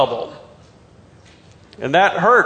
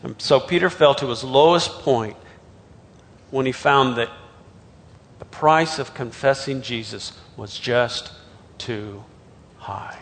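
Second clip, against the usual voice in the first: hushed gravity; 6 semitones lower, 6 dB quieter than usual. Real, not acted. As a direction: let it sink away, softer with each pause. Preacher dwells on Peter's lowest point.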